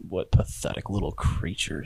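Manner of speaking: low, soft, contemptuous creature voice; Octo. Acted. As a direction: murmur it with a monster's disdain. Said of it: "you hear Octo go".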